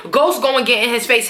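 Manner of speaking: normal talking voice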